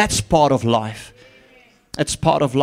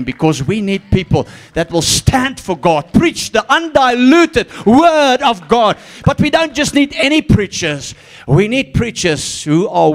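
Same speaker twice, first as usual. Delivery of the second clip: higher, louder, slow preaching build; fiery preaching; 7 semitones higher, 6 dB louder than usual. Acted. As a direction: proclaim it to the church, rising. Preacher calls for true preachers.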